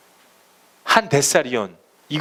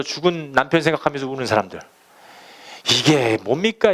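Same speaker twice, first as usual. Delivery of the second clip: ordinary talk, nothing marked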